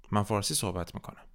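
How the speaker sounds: ordinary delivery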